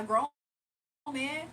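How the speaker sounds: usual speaking voice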